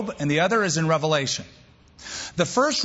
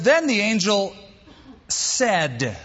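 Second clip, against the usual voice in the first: "said" set apart by a pause, slow and falling; pause, then land firmly on "said"; stress on said; 4 semitones higher, slower than usual. Shown as a demonstration. Preacher asking listeners to mark the verse, stressing "said". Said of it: "mark that".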